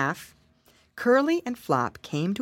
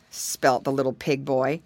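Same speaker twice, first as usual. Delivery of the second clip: plain reading voice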